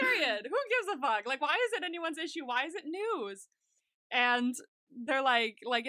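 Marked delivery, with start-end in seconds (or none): 0.0-3.5 higher-pitched, swooping disbelief; emphatic indignation. Real, not acted. Defending a character's love life to co-host, indignant. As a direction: demand why anyone cares, higher and insistent.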